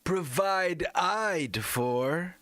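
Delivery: provide eyed drawn out very slow and high, eyed stretched; mock provide eyed. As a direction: savour the odd word provide eyed. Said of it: "added the I D E".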